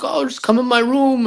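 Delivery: put-on high, coaxing girl's voice; lightly voiced girl luring him in; mimicking her invitation. Girl inviting him to her room, high mimic.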